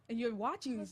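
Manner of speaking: ordinary talk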